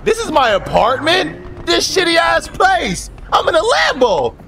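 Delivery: high, slow outraged shouting; indignant yell. Pretend. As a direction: shout in disbelief at the dump. Outraged at seeing his shabby apartment.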